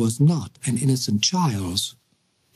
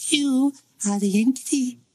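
put-on high little girl voice; childish treble; 10 semitones higher than usual. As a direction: call it like a small child. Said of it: "said a childish treble".

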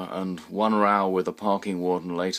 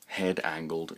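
ordinary delivery, nothing marked

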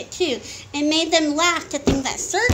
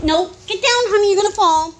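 urgent parental warning; 5 semitones higher, 6 dB louder, faster than usual. Real, not acted. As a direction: call out to stop them falling. Parent warns climbing child.

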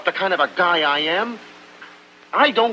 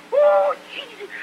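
put-on much higher yelping cry, Italian accent; pained yelp. Tony yelps while Lena scrubs him, a high yelping cry.

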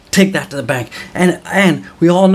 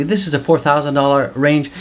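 ordinary talk

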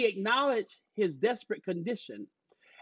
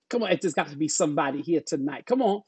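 ordinary delivery

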